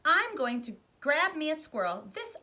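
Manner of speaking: high, loud defiance; defiant bratty shout. Acted. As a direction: snap back like a spoiled brat. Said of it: "shouted Veruca".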